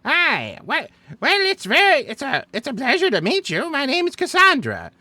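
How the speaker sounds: put-on high, genteel old woman greeting; old lady voice. Shown as a demonstration. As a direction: put on a polite little grandmother voice. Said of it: "Cassandra is just a traditional old lady voice".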